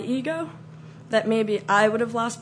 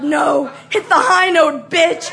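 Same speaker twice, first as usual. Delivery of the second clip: raised, loud, merciless demand; shouted taunt; 7 semitones higher, 8 dB louder, slower than usual. Retold or imitated. Scarer ordering the cornered singer to scream, loud taunt. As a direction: goad her at full voice. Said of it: "And I was like".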